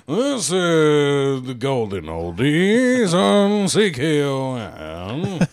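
slow, low oldies announcer; old radio DJ. Retold or imitated. Friend mimicking a Sunday oldies radio host, slow. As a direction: drawl it like a sleepy Sunday DJ. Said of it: "who was like".

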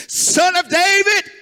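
high, loud plea; crying out. Retold or imitated. Beggar cries out to Jesus, high and loud. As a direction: cry it out like a blind beggar calling. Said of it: "He said".

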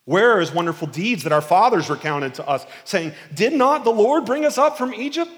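lightly voiced Gideon questioning the Lord; a hint of a bitter Gideon. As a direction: voice Gideon's frustrated protest.

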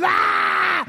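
put-on high yelled scream of a panicked boy; mock scream. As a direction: imitate the son screaming far off. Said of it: "my son's in the neighbor's yard ... screaming".

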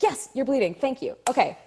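high, quick grateful acknowledgement; retold flustered thanks; relieved recognition. Retells grabbing the fed line.

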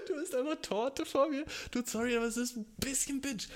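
playful high-pitched grumble; amused mock outrage. Real, not acted. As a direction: tease the friend while still laughing. Amused mock complaint at friend.

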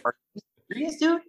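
high-pitched retold disbelief; incredulous. Surfer hearing his friend just ate the wave, incredulous. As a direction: squeak it out in mock disbelief.

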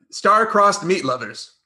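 ordinary talk

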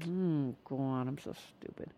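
low mutter fading to a whisper; hushed self-reproach; little inner voice. Acted. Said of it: "this little inner voice that's saying".

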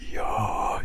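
low, open-throat voiceless whisper; chiaroscuro whisper. Shown as a demonstration. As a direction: whisper with a settled, neutral larynx. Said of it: "it'll feel very soothing".

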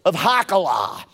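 high, loud sermon storytelling; animated preaching. Real, not acted. Preacher recounting Saul's return home, animated and loud. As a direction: drive the story along at full voice.

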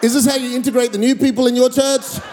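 worked-up mock outrage; imagined outraged rebuke. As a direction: voice her scolding the pastor in indignation. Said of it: "stand up, slap me, go".

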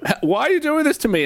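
high, whiny plea as the penis; mock penis complaint. The penis asks the man why, in a high whine. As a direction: squeak it out like a betrayed little member.

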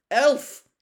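loud, high command; stern. A narrator's reading. Mister Diggory addresses the elf, stern. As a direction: call out sharply and sternly. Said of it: "said Mister Diggory sternly".

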